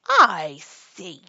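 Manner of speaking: very loud, heavily drawn out; ominous queen. A narrator's reading. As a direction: boom it as an imperious queen. Angry Queen examines the roses.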